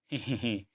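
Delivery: short acted falling giggle; wicked chuckle. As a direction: chuckle before punishing the captives. Acted chuckle before the punishment. (दुष्ट दबी हँसी)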